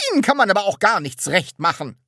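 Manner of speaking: high, loud, quick reproach; reproachful. A narrator's reading. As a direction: scold them for never being satisfied. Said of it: "sagte sie vorwurfsvoll".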